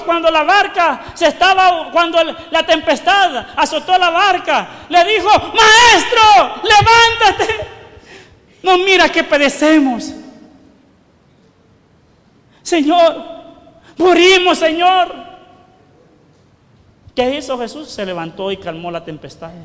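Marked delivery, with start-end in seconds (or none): 5.3-15.1 loud, high cries for help; urgent calling out. Acted. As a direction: call out to wake the master in a storm. Disciples calling out in the storm. (grito urgente de auxilio)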